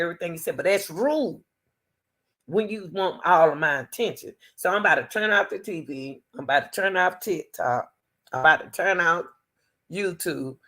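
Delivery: ordinary talk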